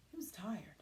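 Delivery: tired hushed whisper. A narrator's reading. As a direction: whisper it as if exhausted.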